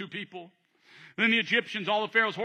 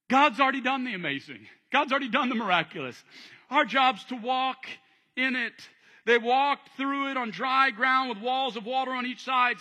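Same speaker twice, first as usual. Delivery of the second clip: usual speaking voice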